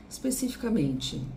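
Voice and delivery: usual speaking voice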